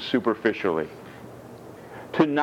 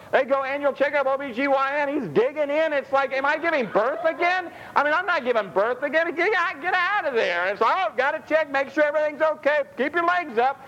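high, animated comic riff voice; lively, pitched-up comic bit; comic storytelling. Acted. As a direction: ham up the doctor visit for laughs. Animated comic doctor-visit riff.

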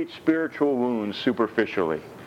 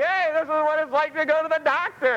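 cheery mock voice.

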